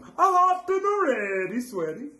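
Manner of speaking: high, slow, mock Swedish lilt; sing-song hammed-up Swedish accent. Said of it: "that sounded kinda Swedish".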